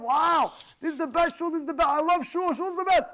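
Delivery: high, excited acted rave; gushing enthusiasm. Newcomer raves about his shul, thrilled. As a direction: gush as a delighted convert would. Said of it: "All of a sudden he feels so warm".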